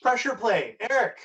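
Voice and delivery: bright, loud welcome-back host; upbeat host announcement. Acted. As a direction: announce the return with energy.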